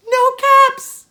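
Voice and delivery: alarmed cry. A narrator's reading. Peddler finds caps gone again.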